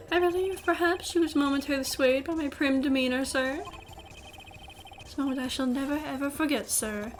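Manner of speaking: prim mock-English bellboy; horrible English accent. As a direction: savour it in an over-proper English accent. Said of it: "saying it in this horrible English accent".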